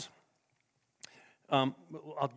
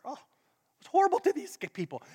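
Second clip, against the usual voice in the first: ordinary delivery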